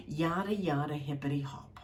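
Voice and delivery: soft, whispered dismissal; whispered aside. A narrator's reading. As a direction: trail off in a whisper.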